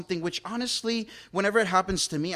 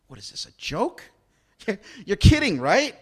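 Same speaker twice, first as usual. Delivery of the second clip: incredulous ruler; 4 semitones higher, 6 dB louder than usual. Retold or imitated. Ruler voiced, disbelief.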